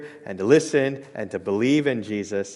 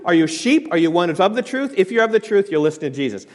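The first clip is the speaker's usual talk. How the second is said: ordinary talk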